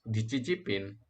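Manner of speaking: ordinary talk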